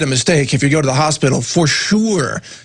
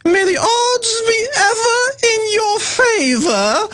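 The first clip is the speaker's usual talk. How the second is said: very high, slow mock British Capitol voice; hammed-up Hunger Games Capitol host voice; posh Capitol accent; slower than usual. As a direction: sing it out like a pompous Capitol announcer. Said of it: "that's how everybody speaks in the Capitol".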